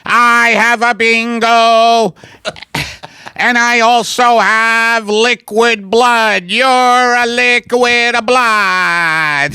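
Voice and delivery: slow, stretched-out Italian alien voice. Acted. Italian alien announces his bingo, slow put-on accent. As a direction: draw every word out as an Italian alien.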